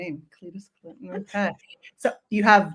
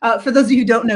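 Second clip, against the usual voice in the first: normal talking voice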